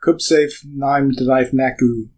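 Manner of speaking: slow sentence spoken backwards; backwards speech. Shown as a demonstration. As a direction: sound out each word in reverse. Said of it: "but then backwards".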